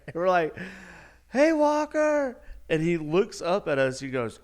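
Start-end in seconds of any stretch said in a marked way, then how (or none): 1.3-2.4 high, drawn-out holler; long, loud sing-out of a name; calling out. Acted. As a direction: call down to someone far below. Calling down from the ski lift.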